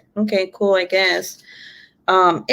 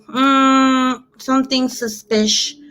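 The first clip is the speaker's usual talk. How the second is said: mock suspicion; 5 semitones higher, much slower than usual. Acting out her suspicion on finding drips.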